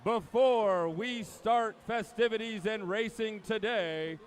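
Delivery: big, drawn-out PA announcer welcome; formal announcer's voice, stretching the key words; meet announcer. Acted. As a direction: slowly roll out each phrase like an arena announcer. Announcer opens swim meet.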